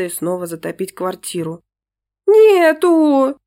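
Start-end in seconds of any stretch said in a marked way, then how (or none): 2.3-3.4 high, loud wail on the verge of tears; tearful cry. Acted. Daughter wailing to her parents that the dog is gone.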